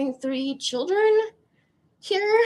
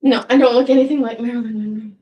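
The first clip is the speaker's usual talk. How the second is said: quick self-correction; 2 semitones lower, 7 dB louder, faster than usual. Real, not acted.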